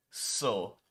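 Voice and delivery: ordinary delivery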